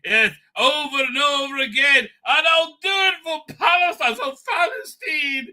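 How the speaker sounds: high, hammed-up parody of the protester; mock him in a high, gushing voice; mocking impression. Mocking the pro-Palestine marcher clip.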